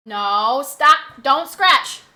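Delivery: loud, firm dog command; sharp scolding. Real, not acted. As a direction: order the dog to stop, loudly.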